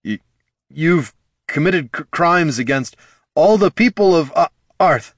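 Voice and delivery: high, halting, nervous stammer; stammering nervously. Acted. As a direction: force the words out through fear. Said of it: "struggled to find his voice".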